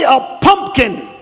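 first syllable of "pumpkin" punched; stress on punk. Shown as a demonstration. Preacher mocking a month-old know-it-all, stress on first syllable of "pumpkin". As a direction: hit the "pump" in "pumpkin" hard. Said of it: "Emphasis on punk".

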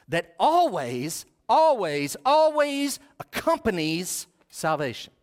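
"always" repeated slowly, each one leaned on harder; stress on always. Real, not acted. Preacher on salvation, "always" slow and emphatic. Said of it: "I emphasize the word".

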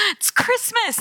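high-pitched, delighted exclamation after a gasp; mock wonder. Parade-goer taking in Christmas, gushing wonder. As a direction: gush with childlike delight.

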